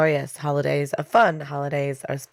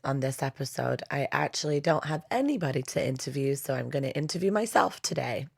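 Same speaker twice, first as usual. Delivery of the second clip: ordinary delivery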